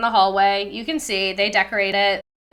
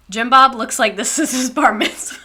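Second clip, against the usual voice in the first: ordinary talk, nothing marked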